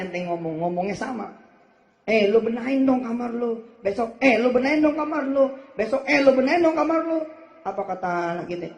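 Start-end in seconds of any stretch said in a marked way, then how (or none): 2.1-7.2 loud, high, rising scold; nagging parent. Retold or imitated. Mother nagging her child to tidy his room, building louder. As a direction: nag louder each time.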